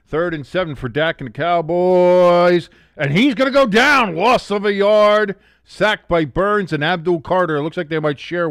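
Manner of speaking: loud, high sports call; excited play-by-play. Real, not acted. Streamer calling the sack to his chat, excited play-by-play.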